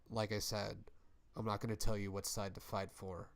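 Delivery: hushed warning on the way out the door; quiet parting threat. Retold or imitated. Warwick warns Alex before walking away, softly and fast.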